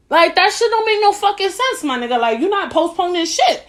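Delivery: heated incredulous rant; indignant outburst. Real, not acted. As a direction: let the outrage spike. Indignant rant about postponed cake.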